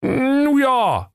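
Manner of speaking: very high, hesitant sidekick; squeaky, reluctant knight-officer; Prinz Meisenherz. A narrator's reading. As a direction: stall in a high put-on voice. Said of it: "fuhr Ritter Prinz Meisenherz fort".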